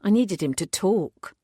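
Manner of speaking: ordinary delivery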